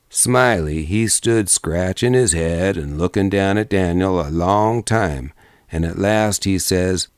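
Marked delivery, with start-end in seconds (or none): none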